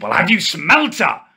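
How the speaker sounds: ordinary talk, nothing marked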